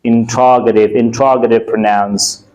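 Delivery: "interrogative" as in-TROG-uh-tiv, repeated as a model; correct pronunciation. Shown as a demonstration. Teacher repeating the dictionary form for students, "interrogative" as in-TROG-uh-tiv. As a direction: model "interrogative" with stress on ROG. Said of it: "It's called interrogative".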